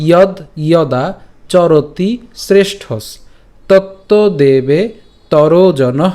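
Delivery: slow, word-by-word Sanskrit recitation; careful recitation demo. Shown as a demonstration. Teacher repeats the verse for learners, slow careful recitation. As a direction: draw out each word, pausing between them. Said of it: "আমি আবার বলছি".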